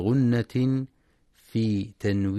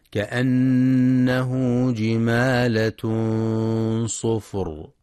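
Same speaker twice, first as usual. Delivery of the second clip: slow, louder chant that settles on the last word; Quran recitation; 3 dB louder, much slower than usual. Shown as a demonstration. Teacher chanting the verse before explaining it, slow. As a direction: chant it out, stretching the opening. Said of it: "في كلمة جمالة".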